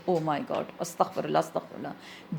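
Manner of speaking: startled whisper of fright at a roar; scared hushed gasp. Pretend. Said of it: "اور یہیں سے ہم ڈر جاتے ہیں".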